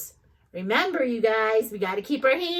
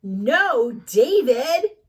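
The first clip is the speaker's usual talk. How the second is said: louder scolding mom, rising on David; exasperated mom; 6 dB louder, much slower than usual. Acted. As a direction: call the boy out, fed up.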